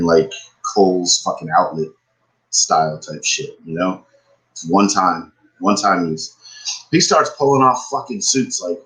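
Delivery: normal talking voice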